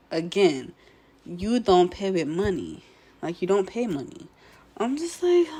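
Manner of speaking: ordinary talk, nothing marked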